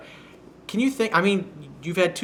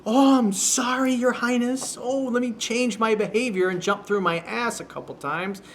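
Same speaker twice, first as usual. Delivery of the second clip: much higher, sarcastic fake apology; mock groveling; 8 semitones higher than usual. Coach mocks the guy apologizing to his date, hammed-up groveling. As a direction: ham up a cringing sorry to royalty. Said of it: "So now he's kinda groveling".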